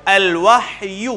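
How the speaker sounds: slow al-wahy, ha stilled; الوحی with sukoon on ha. Shown as a demonstration. Teacher demonstrates tajweed reading, slow. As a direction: read الوحی slowly, ha with jazm. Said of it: "تو اس لفظ کو یوں پڑھیں".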